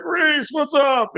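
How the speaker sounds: high, drawn-out retold greeting; hearty greeting. Staff greeting a regular walking in, hearty. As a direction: stretch it out high like a cheery hello.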